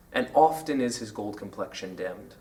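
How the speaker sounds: low, soft recitation of "And often is his gold complexion dimmed"; quoted verse line. Shown as a demonstration. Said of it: "Some people say that the line".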